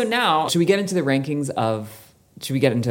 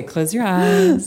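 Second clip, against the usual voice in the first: teasing; 10 semitones higher, 4 dB louder, slower than usual. Pretend. Blind smell instruction.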